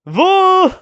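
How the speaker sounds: high loud whoop; excited whoop. Real, not acted. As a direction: whoop with delight. Hype after the hit.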